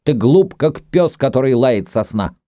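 high-pitched angry insult; indignant shout. A narrator's reading. Rapsun insulting Dagon while grasping his dagger, high indignant cry. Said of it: "крикнул с негодованием Рапсун".

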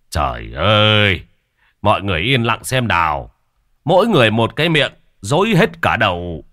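exasperated husband. Acted. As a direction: hush a babbling crowd impatiently. Husband hushes the noisy crowd.

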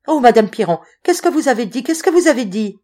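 high, loud, breathless-with-joy questioning; overwhelmed amazement. A narrator's reading. Boy hearing her say she adores him, overwhelmed amazement.

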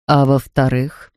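a measured family father; Mr Brown. Acted. Father lays out his plan to the family, slow and low.

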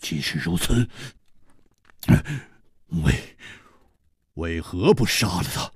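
low, halting, stunned question; hesitate, stumbling dazedly over the words. Acted. Song Gang asking the killer after a long silence, dazed. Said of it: "痴痴地说".

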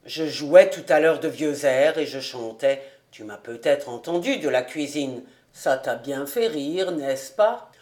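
Fritz voiced. A narrator's reading.

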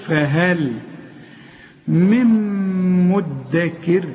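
slow فهل, hummed م into مدكر; model letter articulation. Shown as a demonstration. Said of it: "اتعلم من القرآن الحروف".

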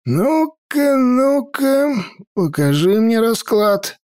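put-on old mage voice. A narrator's reading.

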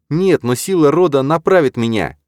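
high, loud, fervent declaration; exalted. A narrator's reading. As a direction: proclaim it with faith. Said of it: "окрылённая девушка".

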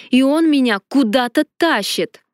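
ordinary delivery, nothing marked

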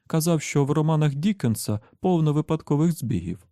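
high, animated woman character voice; lightly voiced explaining Susannah. A narrator's reading. Susannah tells Roland about Dickens, high animated explanation. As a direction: explain eagerly, pitched well up.

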